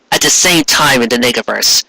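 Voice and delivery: ordinary delivery, nothing marked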